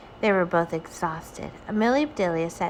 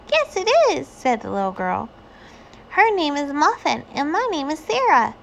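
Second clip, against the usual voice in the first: put-on high, bright child; little girl voice; 8 semitones higher, 4 dB louder than usual. A narrator's reading. Sarah introducing herself and her cat, childlike. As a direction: speak like a proud little girl.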